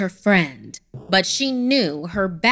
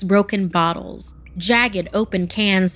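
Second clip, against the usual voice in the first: usual speaking voice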